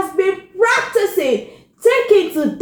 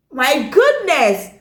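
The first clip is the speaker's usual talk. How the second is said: plain reading voice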